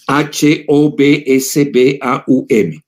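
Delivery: careful spelling at half pace, each letter distinct. Shown as a demonstration. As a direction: dictate H O B S B A U M letter by letter. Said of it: "se escribe, a ver, porque no me equivoque".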